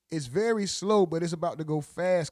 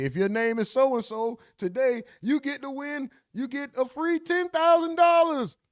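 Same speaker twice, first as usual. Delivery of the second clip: mock prize announcer; 9 semitones higher than usual. Mocking a prize draw announcement.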